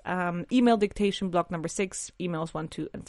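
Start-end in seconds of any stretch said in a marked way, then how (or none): 2.2-3.1 whispered. Real, not acted. Aside while listing.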